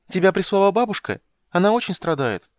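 put-on high, loud child asking; childish eager question; little girl voice. A narrator's reading. (голос маленькой девочки)

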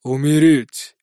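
cold, clipped order; icy command. A narrator's reading. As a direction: command death with ice in the voice. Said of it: "ледяным тоном приказал".

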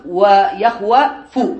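ويخوف stretched out slowly; teacher slowly repeating ويخوف; slow demo. Shown as a demonstration. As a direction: draw out ويخوف sound by sound. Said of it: "هنا قال في الحاشية".

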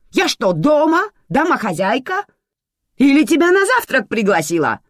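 high, loud indignant questions; angry bark. A narrator's reading. Manana reminds Sergo this is no home, barking. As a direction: fire off outraged rhetorical questions. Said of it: "рявкнула Манана".

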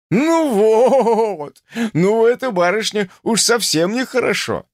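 put-on high, loud coddling as to a small child; professor's exclamation. A narrator's reading. Professor seeing his patient's tears, patronizing exclamation. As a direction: jovially scold in a high baby-talk tone. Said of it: "воскликнул профессор".

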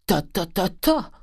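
climbing ta ta ta up four half steps; four chromatic tones. A narrator's reading. The cooper cutting off his daughter, rising chromatic ta ta ta. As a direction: sing the ta's up a chromatic scale. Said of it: "sur quatre tons chromatiques".

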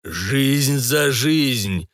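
very slow, eerie demonic voice from a portal; distorted bass. Acted. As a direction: drag each word out like a hellish portal. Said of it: "молвил портал искажённым басом".

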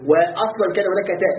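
ordinary delivery

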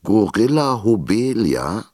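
put-on slow, high mishearing old man; puzzled old teacher. A narrator's reading. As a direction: repeat the name as if unsure. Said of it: "polterte der alte Schullehrer". (alter Lehrer fragt langsam und verwirrt nach)

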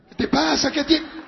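high, loud acted protest; indignant protest. Preacher demonstrates refusing a kiss, indignant high protest. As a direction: push them away with your voice.

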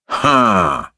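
short doubtful huff; grudging little grunt; muttering judge. A narrator's reading. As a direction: mutter a sceptical interjection. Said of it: "пробормотал доктор Корпус".

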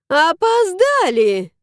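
high, slow gloating cry; gleeful. Acted. Nanny gloats that they are too late. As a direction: crow over the win. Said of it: "обрадовалась няня".

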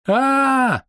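long, high-pitched held cry; high wail held on one steady note; high flying scream. A narrator's reading. Alchemist flung over the stairs, screaming high. Thrown alchemist cries out mid-flight.

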